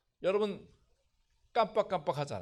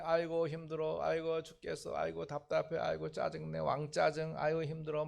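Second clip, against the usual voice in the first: complaining groan; 2 semitones lower, 7 dB quieter than usual. Retold or imitated. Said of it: "짜증만 찾아다니는 사람".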